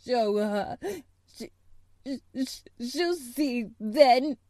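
broken, sobbing gasps with long halts; gasping between sobs. A narrator's reading. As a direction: force the words out between sobs. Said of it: "he gasped out between two sobs".

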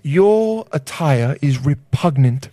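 slow, affected Cockney put-down; Cockney accent. Acted. Cockney gentleman insulting someone's attire, affected Cockney accent. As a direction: pronounce it grandly in a Cockney accent. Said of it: "why is he Cockney".

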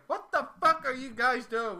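retold angry chef. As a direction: imitate a chef catching slackers. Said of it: "my chef comes out there".

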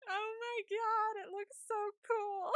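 high, slow, almost crying with excitement; gushing. Real, not acted. Gushing to co-host about the eighties tour stage show, high and teary. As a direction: swoon over how cool it looks. Gushing over concert footage.

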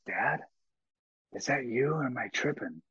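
soft, hazy voice of a son waking up; half-awake, unsure who's there; groggy son. Retold or imitated. Groggy son wakes after surgery.

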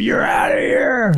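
high, slow hammed-up yell; mock shout. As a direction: bellow it like a cartoon bouncer. Joking mock shout at friend.

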